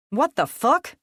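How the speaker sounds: high, loud shocked blurt; stunned outburst. Acted. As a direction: blurt it while backing off. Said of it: "Reed blurted".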